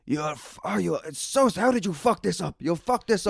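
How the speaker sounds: high, exasperated mock scolding; imitating the boss losing it, pitched high; mimicking a furious boss on the phone; angry boss again. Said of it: "He's like".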